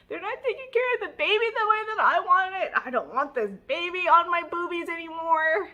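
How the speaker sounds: high, loud, fast hammed-up whine; mock-whine like a frazzled control freak; whiny complaint. Frazzled new mom complaining to her friend, hammed-up high whine.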